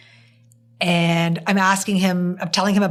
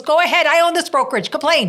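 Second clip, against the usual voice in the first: defiant boss; 8 semitones higher, faster than usual. Retold or imitated. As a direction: throw it back at him, daring. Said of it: "She goes".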